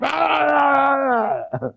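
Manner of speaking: high, drawn-out wordless raving; hammed-up wail of ranting gibberish; mock ranting yell. Leader imitating his old half-hour rants, hammed-up raving. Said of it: "rant and rave".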